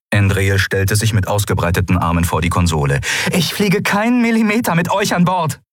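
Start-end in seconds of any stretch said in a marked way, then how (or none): 3.3-5.6 high-pitched, heated refusal; defiant refusal. A narrator's reading. Pilot blocking the console against her crew, defiant.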